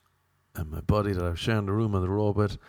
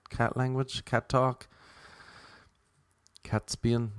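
usual speaking voice

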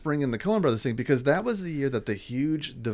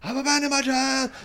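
high-pitched mock wail of confession; insane preacher; 11 semitones higher than usual. Quoting the film's confession scene, hammed-up insane preacher. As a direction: cry it out like a crazed preacher. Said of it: "he's also an insane preacher".

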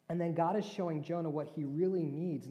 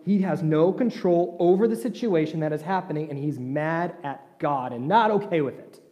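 louder, driving sermon delivery; full-force preaching; 12 dB louder than usual. Acted. Pastor preaching loudly about Jonah's anger. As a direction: preach it hard to the congregation.

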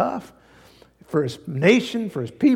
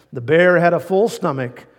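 ordinary talk

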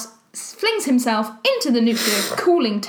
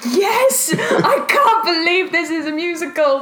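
excited; 5 semitones higher than usual. Retold or imitated. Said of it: "This is the one where I was like".

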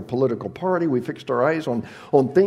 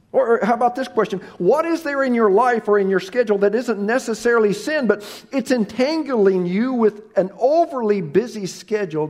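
louder, higher, quicker push; fiery preaching; 7 semitones higher, 6 dB louder than usual. Acted. Preacher challenges the congregation, preaching hard. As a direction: press the question home.

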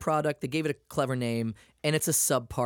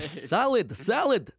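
a hint of an angry stranger, higher on the second "salad"; mock angry yell; 5 semitones higher, 3 dB louder, much slower than usual. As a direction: replay the man's angry word. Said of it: "He was very angry too".

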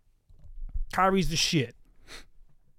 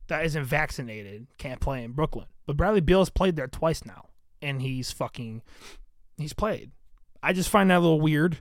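normal talking voice

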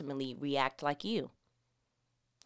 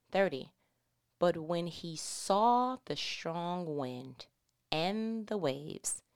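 ordinary talk, nothing marked

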